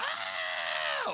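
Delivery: drawn-out "aah" cry; imitated scream. Pastor retelling the woman's screams, imitated scream. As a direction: imitate a long, sustained scream. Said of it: "Y gritaba".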